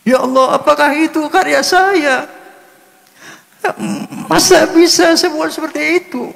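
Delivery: high, tearful voice choking up in disbelief; real crying. Real, not acted. Overwhelmed man recalls hearing his own songs, crying. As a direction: weep through the words, pitched very high. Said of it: "Saya menangis".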